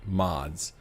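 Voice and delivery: ordinary talk, nothing marked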